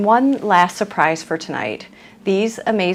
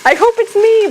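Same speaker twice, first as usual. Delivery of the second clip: high excited squeal; 13 semitones higher, 5 dB louder than usual. Real, not acted. Jokes about the grand prize.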